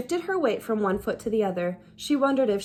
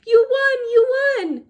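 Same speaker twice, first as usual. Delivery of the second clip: very high, loud joyful yelling; joyful cry; 13 semitones higher, 8 dB louder than usual. Acted. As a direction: shriek it with delight.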